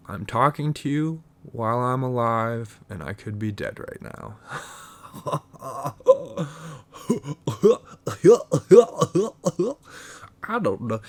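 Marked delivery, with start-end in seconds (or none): 5.0-10.4 long, high, hiccuping put-on chuckle; goofy cartoon laugh.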